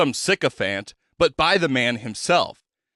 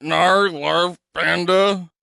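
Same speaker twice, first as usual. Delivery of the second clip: slow, slurred nonsense words; garbled protest; 5 semitones higher, much slower than usual. Acted. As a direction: try to object but only mush comes out.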